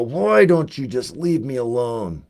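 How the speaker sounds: slow, drawn-out brooding actor impression; James Dean. Actor covering a forgotten line, slow dramatic plea. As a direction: stretch it out like a young screen rebel. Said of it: "if you watch James Dean, he'll just go".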